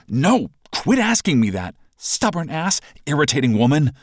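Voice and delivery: annoyed retort. Acted. Snapping back in argument.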